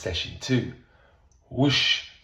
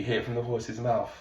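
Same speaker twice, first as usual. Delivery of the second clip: ordinary talk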